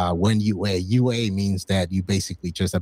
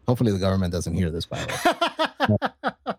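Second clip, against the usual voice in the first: laughing aside. Real, not acted. Joking aside about government.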